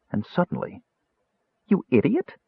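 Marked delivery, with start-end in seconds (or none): 1.7-2.4 high-pitched excited self-rebuke. A narrator's reading. Kender telling himself off on a sudden idea, excited. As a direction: call yourself out in sudden excitement. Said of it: "he told himself excitedly".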